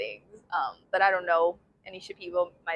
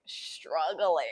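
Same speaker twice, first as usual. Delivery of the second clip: drawn-out struggling; 4 semitones lower than usual. Retold or imitated. Comic exaggeration of others' struggle.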